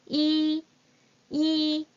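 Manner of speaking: 이 said clearly as Mandarin yī, level first tone; model pronunciation. Shown as a demonstration. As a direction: hold 이 high and level. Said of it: "teacher models number one".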